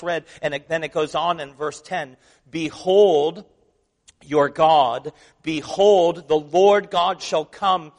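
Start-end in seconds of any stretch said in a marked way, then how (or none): 2.5-7.9 slow, louder proclamation of "Behold"; preaching scripture. Acted. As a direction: proclaim it to the congregation, slow and strong. Reads scripture with rising force.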